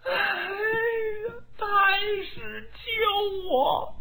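high, sobbing woman's cry for help; crying plea. Acted. Diaochan sobs to the Grand Tutor for rescue, high and tearful. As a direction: wail for rescue through tears. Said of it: "貂蝉哭了".

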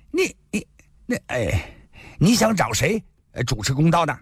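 stammering, high-pitched exasperation; sputter, then force the question out; frustrated sputter. A narrator's reading. Pockmarked man gives in grudgingly, frustrated sputter. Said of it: "麻子咬了咬牙".

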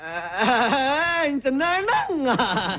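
slow, drawn-out protest; long incredulous wail; dismayed disbelief. Acted. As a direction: stretch it out in disbelief. Dismayed on hearing horse will collapse.

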